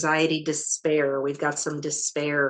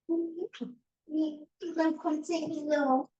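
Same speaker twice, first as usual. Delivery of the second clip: much higher, slow, softer coaxing; 11 semitones higher, 6 dB quieter, much slower than usual. Real, not acted. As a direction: croon it gently to the cat. Coaxing the cat.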